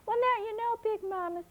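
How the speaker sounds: put-on high, earnest child; little girl voice. A narrator's reading. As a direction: pitch way up, a small girl pleading. Flossie talks to grandma.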